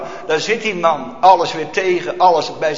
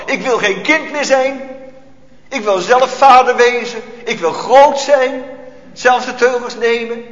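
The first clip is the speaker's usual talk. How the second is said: put-on defiant proud child; 6 semitones higher, 4 dB louder than usual. Voicing the proud sinful heart.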